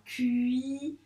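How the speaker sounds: "Cuisine" drawn out as cui, zi, ne; reading by syllables. Shown as a demonstration. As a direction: separate and stretch each syllable of "Cuisine". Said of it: "réessayer de le lire".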